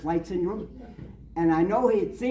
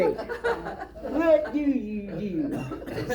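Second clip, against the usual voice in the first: laughing repeat; 4 semitones higher, much slower than usual. Retold or imitated.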